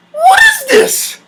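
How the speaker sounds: very high, slow, strained cry; mock horrified wail. Reacting to two minutes, high mock wail. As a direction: shriek it slowly in disbelief.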